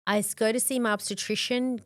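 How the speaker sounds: usual speaking voice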